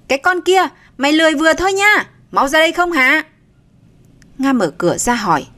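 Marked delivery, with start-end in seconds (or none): 0.1-3.2 high-pitched, raised-voice telling-off; loud scolding. A narrator's reading. Aunt scolding from outside the room, loud and carrying. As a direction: call out crossly, high and insistent. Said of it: "giọng bà oang oang phát ra".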